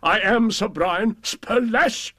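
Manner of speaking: slow, high proud boast, stress on am; I am, each word swelled with pride; hammed-up knight crowing his own name; boastful knight.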